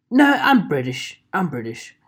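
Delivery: lightly hammed-up British accent; mock British accent. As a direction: insist in a put-on British voice. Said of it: "making a joke out of my accents".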